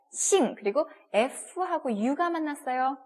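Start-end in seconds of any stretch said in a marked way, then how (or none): 0.1-0.5 싱 starting high and falling; fourth tone. Shown as a demonstration. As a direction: start 싱 high, then drop it. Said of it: "사성이니까".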